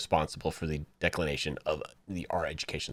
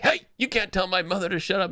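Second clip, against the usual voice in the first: indignant son; 9 semitones higher than usual. Retold or imitated. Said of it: "he's like".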